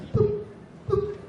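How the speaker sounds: high, short electronic beeps; two quick high-pitched boops; imitated beeps. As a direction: mimic the recording start tone. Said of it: "the three beeps right before you start recording".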